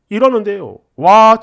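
ordinary delivery

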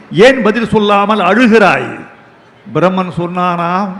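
ordinary talk, nothing marked

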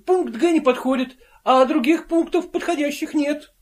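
high, pleading protest; agitated plea. A narrator's reading. Invisible man pleading with comrades, high and agitated. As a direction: plead anxiously not to be fired.

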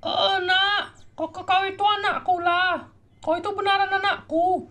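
a mother overjoyed to find her son; mother character voice. Acted.

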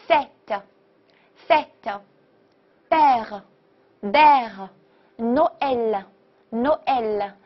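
"fête，fête，père，père，Noël，Noël" very slowly, open eh; big-mouth è. Shown as a demonstration. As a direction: model "fête，fête，père，père，Noël，Noël" one by one, pausing between. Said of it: "剩下其他的发A，A大口".

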